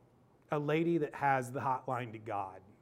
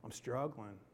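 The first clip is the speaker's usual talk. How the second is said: softer, voice welling with tears; preacher tearing up while telling his story; 2 semitones lower, 11 dB quieter, slower than usual. Real, not acted. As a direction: hold back tears as you speak. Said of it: "the tears start to well up".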